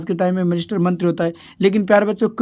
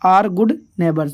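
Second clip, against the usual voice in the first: slow dictation; much slower than usual. Shown as a demonstration. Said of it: "इसका सही answer क्या होगा".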